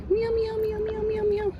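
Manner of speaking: high sing-song meows to coax a cat; meowing to a cat. Real, not acted. Vlogger calling a stray cat, squeaky high meowing.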